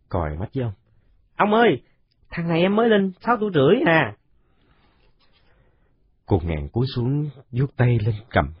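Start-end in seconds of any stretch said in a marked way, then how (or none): 1.3-4.1 put-on very high, quick child's call; tattling kid. Còi tells the old man the boy's age, very high and quick. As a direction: blurt it out to grandpa.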